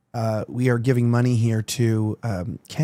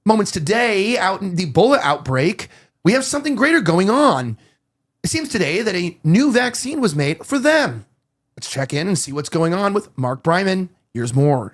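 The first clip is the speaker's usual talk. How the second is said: mock a teleprompter-trained anchor, dragging words out; news anchor cadence; 4 semitones higher than usual. Shown as a demonstration. Anchor looking into camera on the Ebola outbreak, news anchor cadence. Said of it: "they always just drag it out".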